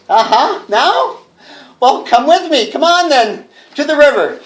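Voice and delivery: high, eerie, sing-song compliance; entranced madness. Acted. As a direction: follow the ghosts, lost. Going mad, led to river.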